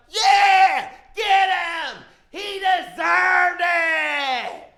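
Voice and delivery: high shouted cheer, last words stretched slow; mob shout. Retold or imitated. The whole world cheering the pictures, high slow shout. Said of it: "the whole world is like".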